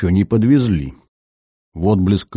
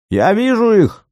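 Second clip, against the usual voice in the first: shrieking yell; 14 semitones higher than usual. A narrator's reading. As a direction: shriek it out wildly. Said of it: "истошно заголосил полицай".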